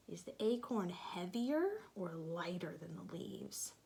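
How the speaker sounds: soft whispered question. Real, not acted. Teacher whispering a question to children.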